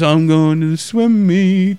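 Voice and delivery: slow, lightly voiced sleepwalker; dazed sleepwalker. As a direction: say it like a sleepwalker in a daze.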